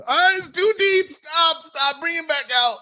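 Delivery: very high, slow, hammed-up shout; mock shriek. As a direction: squeal for the doctor to stop. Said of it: "It was".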